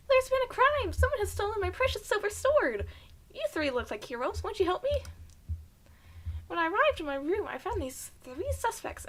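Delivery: high, loud, excited little mouse voice; frantic mouse. Acted. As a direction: squeak out the plea like a panicked tiny mouse. Reads mouse's plea in voice.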